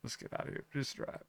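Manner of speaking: urgent whisper. Retold or imitated. Narrator urging his friend to flee, in a whisper. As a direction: breathe it out, unvoiced, hurry. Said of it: "I was like".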